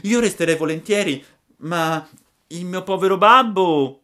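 high, loud puppet voice, hurried then pleading; a boy torn between staying and father; worried puppet. Acted. Pinocchio worries about his father. (burattino preoccupato per il babbo)

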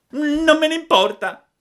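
very high, loud sulky retort; defiant puppet. A narrator's reading. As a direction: refuse loudly like a stubborn child. Defies warning of death. (burattino testardo, strillo acuto)

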